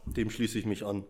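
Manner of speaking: ordinary talk, nothing marked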